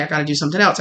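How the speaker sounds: ordinary talk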